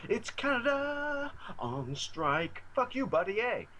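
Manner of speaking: high, slow mock Canadian rant; Canadian South Park quote. Quoting South Park to the hosts in mock Canadian accent. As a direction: ham up a cartoon Canadian accent. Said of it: "just all the Canadian episodes".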